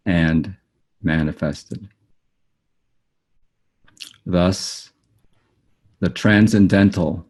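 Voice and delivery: solemn, unhurried recital of a written teaching; formal reading aloud. Acted. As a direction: read it deliberately, phrase by phrase, letting each term stand. Reads own written description aloud.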